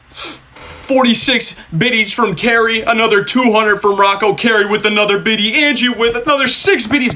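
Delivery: high-pitched, sustained excited roll call; hyped shout-outs. Real, not acted. Streamer thanks viewers for their biddies, hyped. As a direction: call out each name high and thrilled.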